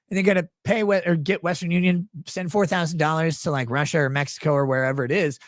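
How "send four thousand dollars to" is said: usual speaking voice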